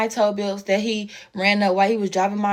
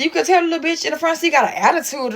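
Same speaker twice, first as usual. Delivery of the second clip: normal talking voice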